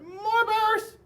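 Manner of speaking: put-on high, loud kids' shout; high-pitched holler of boys and girls; children yelling. A narrator's reading. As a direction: yell it like an eager crowd of kids. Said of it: "sounded just like boys and girls".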